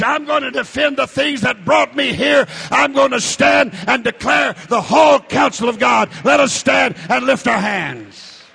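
loud, high preacher's cry; full-force revival preaching; fiery preaching. Real, not acted. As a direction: preach it hard to the congregation.

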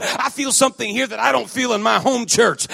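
forceful preaching, building in volume and pitch; volle prediking. Acted. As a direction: drive each phrase home to the congregation.

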